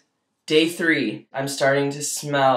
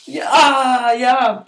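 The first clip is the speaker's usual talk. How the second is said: pained yelp; 8 semitones higher, 6 dB louder, much slower than usual. Real, not acted. Wound dressing removed, pain.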